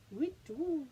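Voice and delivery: owl hoot. Pretend. Owl call in bedtime book.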